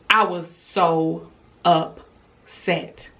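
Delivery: slow, word by word, upset broken in two; emphatic pauses. Retold or imitated. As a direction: space out every syllable for emphasis. Recalling her shock at the hair.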